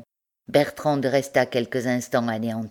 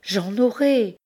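trembling; 8 semitones higher, slower than usual. Acted. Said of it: "dit la pauvre femme toute tremblante".

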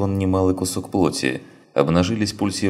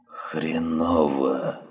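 weak, slow, faint dying voice; dying wounded man; 4 dB quieter, much slower than usual. Acted. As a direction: barely get the words out, fading. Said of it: "констатировал Бидон".

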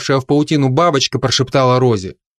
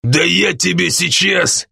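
slow, higher-pitched threatening growl; drunken threat; 5 semitones higher than usual. A narrator's reading. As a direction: threaten him like an angry drunk. Drunk threatens the young bard. (пьяная злая угроза)

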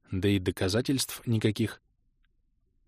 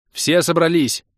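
shouted order; 6 semitones higher, 6 dB louder than usual. A narrator's reading. Hero calls team together.

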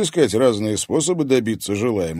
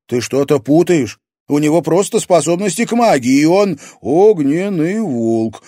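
mocking; 7 semitones higher, 7 dB louder than usual. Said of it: "насмешливо договорил Герман".

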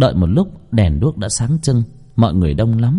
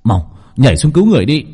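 fast, raised-pitch rescue command; urgent order; 4 semitones higher, 4 dB louder, faster than usual. Acted. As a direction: send them in to save him, quickly. (giục giã cứu người gấp gáp)

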